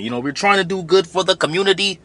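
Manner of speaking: sneering echo of the clip; mocking imitation. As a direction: parrot their slogan back with scorn. Mocks the quote from the clip.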